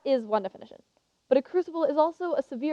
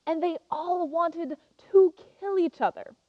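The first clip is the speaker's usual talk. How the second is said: ordinary talk, nothing marked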